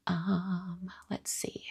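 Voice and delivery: hushed muttering to herself; soft unvoiced murmur while reading comments; whispered aside. Real, not acted. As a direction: whisper it under your breath while scanning.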